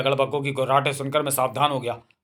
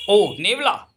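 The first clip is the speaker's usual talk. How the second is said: alarmed cry; 5 semitones higher, 3 dB louder, much slower than usual. Acted. Snake sees the mongoose.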